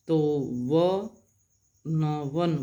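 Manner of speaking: व and न split apart, then वन; sounded out. Shown as a demonstration. Teacher showing the व sound in वन. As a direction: break वन into व, न, then join it. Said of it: "व की sound हो रही है".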